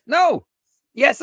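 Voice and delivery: ordinary delivery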